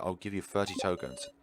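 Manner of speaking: ordinary talk, nothing marked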